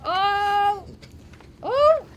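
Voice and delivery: high, loud mock surprise; surprised. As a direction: act out a big shocked gasp. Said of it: "And I was like".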